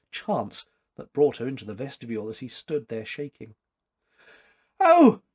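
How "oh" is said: sharp high startled oh; startled cry. Acted. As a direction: cry out in sudden alarm. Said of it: "she cried".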